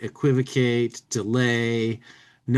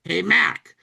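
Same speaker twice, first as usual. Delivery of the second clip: hustler voice; 7 semitones higher, 4 dB louder than usual. Acted. Hustler in comic bubble.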